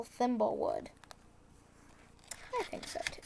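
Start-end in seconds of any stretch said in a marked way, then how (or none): 2.6-3.2 soft unvoiced whisper; hushed little aside; whispered agreement. Real, not acted. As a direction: whisper it to yourself.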